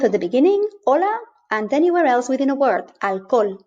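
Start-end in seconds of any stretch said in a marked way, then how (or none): 0.8-1.2 hola starting straight on the O; Spanish hola with no H sound; silent H. Shown as a demonstration. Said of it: "it's completely silent". 3.0-3.6 alcohol with the H dropped, Spanish vowels; Spanish alcohol with no H sound; silent H. Shown as a demonstration. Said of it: "it's completely silent".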